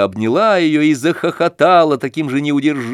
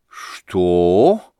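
низкое протяжное «Что?»; протянуть в изумлении; в ужасе; 7 semitones lower than usual. A narrator's reading. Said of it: "в ужасе протянула". (изумлённая протяжка матери)

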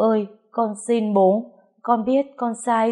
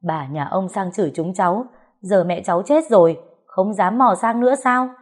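furious yelling; 5 dB louder than usual. Acted. Grieving child screaming at the man blamed for mother's death, furious. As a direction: spit the mocking words back, building to a shriek. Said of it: "điên điên lên chửi bới".

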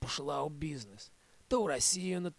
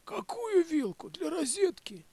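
high, incredulous voice, much higher; baffled question; 13 semitones higher than usual. Acted. He answers grandma's accusation, high and baffled. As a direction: play dumb in a squeaky high voice. Narrator's baffled retort quoted.

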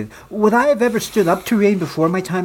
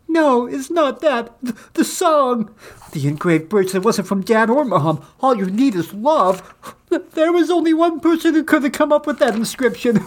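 tearful; 7 semitones higher than usual. A narrator's reading. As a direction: read it as if tears are welling up. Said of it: "tears streaming down my cheeks".

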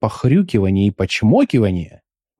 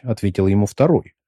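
ordinary talk, nothing marked

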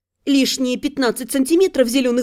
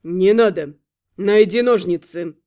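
curt warrior; 7 semitones lower than usual. A narrator's reading. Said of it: "отрезал воин".

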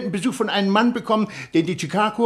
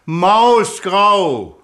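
louder, insistent repeat; 6 dB louder than usual. Acted. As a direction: say it again, slower and firmer, to a caller who didn't catch it. Repeating colour louder to radio caller.

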